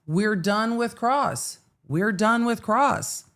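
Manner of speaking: high, slow, with a falling "cross"; high singsong. Shown as a demonstration. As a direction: lift it up high and drag it out. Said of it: "repeats the phrase in different ways".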